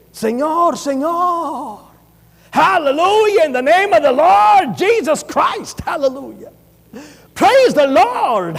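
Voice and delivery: high, slow, hammed-up English-accented praise; mocking showy preacher. Preacher mocking showy Pentecostals, slow hammed-up English praise. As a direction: lay on the fake showman holiness. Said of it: "lo quiere hacer con acento de inglés".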